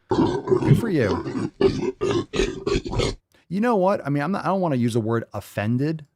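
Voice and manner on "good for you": very high, slow squealed praise; cartoon-high mock praise. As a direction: squeak it out mockingly. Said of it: "repeated in squeaky voice".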